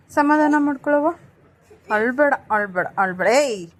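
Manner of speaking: high, loud coaxing. Real, not acted. Soothing her crying baby.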